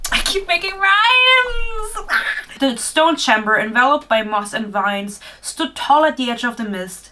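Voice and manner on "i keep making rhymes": high, slow, drawn-out squeal of delight; amused disbelief. Real, not acted. Reader catching her own rhymes, delighted stretched squeal. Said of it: "Oh my god, no way".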